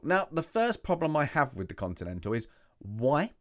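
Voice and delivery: usual speaking voice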